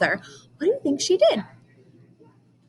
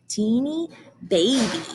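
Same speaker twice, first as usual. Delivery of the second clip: usual speaking voice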